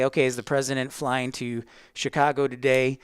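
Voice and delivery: ordinary talk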